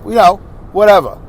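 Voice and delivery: ordinary talk, nothing marked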